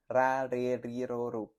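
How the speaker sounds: "Ra, re, ri, ro, ru" with a trilled R; teacher's trill drill; R syllable drill. Shown as a demonstration. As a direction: roll each R syllable. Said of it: "no muy rápido y no muy despacio".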